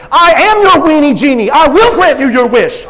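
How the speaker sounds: loud, high proclamation; yelled. Retold or imitated. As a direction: boom it out like a genie. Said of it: "Al just yells".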